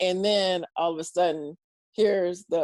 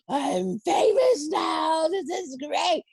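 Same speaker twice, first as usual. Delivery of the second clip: higher, slow, shouted joy at sudden fame; mock thrilled shout; 7 semitones higher, much slower than usual. Comic recalling her own thrill, shouting with glee. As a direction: belt it out like you just went viral. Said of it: "and you're just like".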